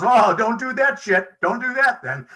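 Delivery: high, loud startled blurt; alarmed warning. Retold or imitated. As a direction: yelp it like a horrified friend. Greg recoils at workshop topic.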